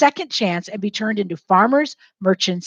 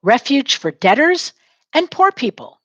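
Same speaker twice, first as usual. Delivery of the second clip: "Refuge for debtors and poor people" lilting, "poor" pitched very high; a bouncy call for the class to echo; sing-song model line; 7 semitones higher, 3 dB louder than usual. Shown as a demonstration. Teacher giving a line for students to repeat, sing-song and high. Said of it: "Say it".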